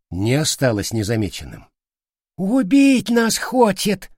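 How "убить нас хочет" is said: high, slow, stretched alarm; alarmed cry. Acted. Soldier spots the pistol being drawn, alarmed. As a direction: cry out a warning to the gang.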